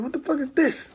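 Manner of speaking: high, softened disbelief; retold bewilderment. Man finding a strange box above him, bewildered.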